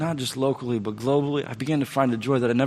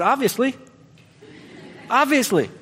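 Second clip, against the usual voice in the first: defensive; 11 semitones higher than usual. Retold or imitated. Defensive reply to God.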